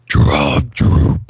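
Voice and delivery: very low, strained growl of an unseen creature; creature imitation. Shown as a demonstration. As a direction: force the voice way down into a raspy rumble. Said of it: "I can try to imitate what it said".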